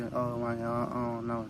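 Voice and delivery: soft, hesitant and unsure; unsure mumble. Retold or imitated. Imitating someone who can't give directions, meekly unsure. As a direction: trail off like you have no answer. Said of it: "you don't want nobody giving you directions and they just be".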